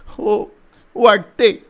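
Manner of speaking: put-on girl answering through dry sobs. A narrator's reading. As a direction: catch the breath between words. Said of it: "कोरडे हुंदके देत मुलगी म्हणाली".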